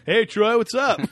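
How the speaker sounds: high, loud mock hail; shouted greeting. Joking co-host hailing the movie character Troy, playful shout. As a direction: call out to a pal, laughing.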